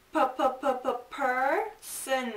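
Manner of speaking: person with the p repeated and aspirated; sound drill. Shown as a demonstration. Teacher to students, breaking person into its first sound. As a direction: tap out p, p, p then person. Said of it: "teacher isolating the p sound".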